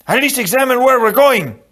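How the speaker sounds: high, loud, exasperated plea; urgent call. A narrator's reading. Inigo calling after Fezzik on dark stairs, urgent shout.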